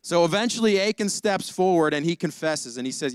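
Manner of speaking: usual speaking voice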